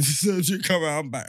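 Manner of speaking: normal talking voice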